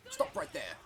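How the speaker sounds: a hint of a stern town guard halting you; Skyrim guard impression. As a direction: Skyrim guard line, mildly hammed-up. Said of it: "Him with that Skyrim".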